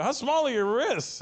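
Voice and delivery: high, slow teasing question; hammed-up comic disbelief; mock incredulous. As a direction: draw it out in playful amazement. Retold repeat of the teasing question.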